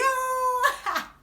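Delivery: a high squeal of a laugh; high shocked laugh. Real, not acted. She recalls the warning, high squealing laugh.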